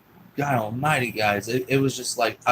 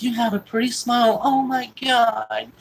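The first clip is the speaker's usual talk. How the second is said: overcome gushing; 11 semitones higher, 4 dB louder than usual. Retold or imitated. Woman delighted by the boy's smile, overcome gushing. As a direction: imitate a woman melting over a child.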